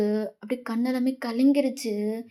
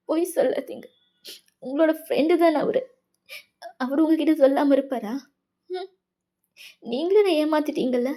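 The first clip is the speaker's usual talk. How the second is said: higher, upset disbelief; hurt, accusing junior; 4 semitones higher than usual. Acted. Wayan refusing to believe her senior, hurt and accusing. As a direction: accuse someone you trusted of lying.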